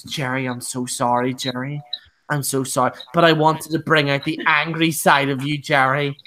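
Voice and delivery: low, subdued imitation of an apology; mock apology. Yanni's apology acted out.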